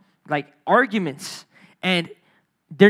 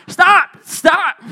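urgent shout; 9 semitones higher, 7 dB louder than usual. Retold or imitated. Breaking up the fight.

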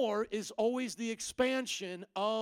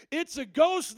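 mock fearful cry; 6 semitones higher, 7 dB louder, slower than usual. Disciples cry out in fear.

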